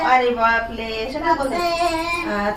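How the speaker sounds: ordinary talk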